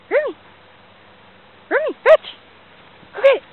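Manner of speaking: loud, high call to the dog, pushing on fetch. Real, not acted. Owner calling dog to fetch, loud high call. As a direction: shout it across the yard so the dog hears.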